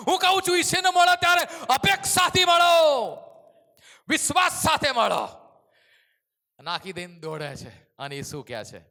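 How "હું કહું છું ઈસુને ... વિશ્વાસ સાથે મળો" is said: loud, high preacher's cry; rising revival sermon shout; fiery preaching. Acted. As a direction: preach it out with full force. Preaching at full force.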